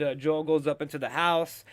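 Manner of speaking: ordinary talk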